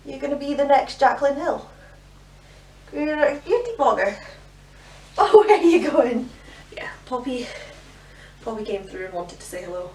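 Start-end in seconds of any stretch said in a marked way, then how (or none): 0.0-4.2 high, loud gushing praise. Real, not acted. Mother cooing over toddler's tutorial. 5.2-6.3 playful call. Real, not acted. Calling after toddler.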